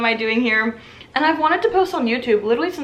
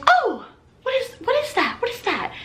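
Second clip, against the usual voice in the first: high, fading voice of a nosy customer; chatty wife; 13 semitones higher than usual. Retold or imitated. The chatty wife asks about the necklace, high and nosy. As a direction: ask it high and sweet, trailing off.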